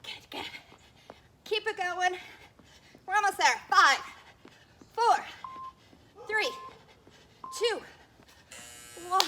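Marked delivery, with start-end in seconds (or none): 1.5-9.2 shouted encouragement, pushing hard; loud coaching shout. Real, not acted. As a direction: coach yelling the last reps. Coach pushing through final countdown.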